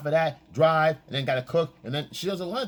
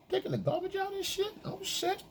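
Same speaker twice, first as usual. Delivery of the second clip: pitched way up, softer and lazy; mock high boyfriend voice; 7 dB quieter than usual. Mocking the clueless boyfriend, high and soft. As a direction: play the clueless guy, lightly. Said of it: "and you go".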